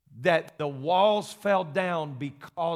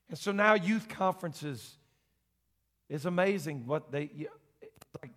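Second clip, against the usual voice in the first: ordinary talk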